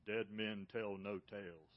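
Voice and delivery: slow, low, ominous delivery of Dead men tell no tales; ride pirate line. Retold or imitated. Quoting the Disney ride's pirate warning, slow and low. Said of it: "what do they say?".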